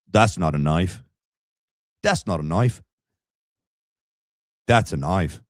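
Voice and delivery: put-on amateur Aussie accent, deliberate pauses; Crocodile Dundee. Practising a film line in a mock Australian accent. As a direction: attempt a movie-quote Australian accent. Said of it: "I'll watch some Crocodile Dundee".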